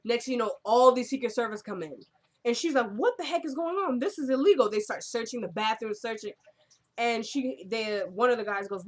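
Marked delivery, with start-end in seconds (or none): 2.9-4.7 raised pitch, lightly indignant; a touch of retold outrage; mock alarmed protest. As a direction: act out her shock at being raided. Acting out her alarm at agents.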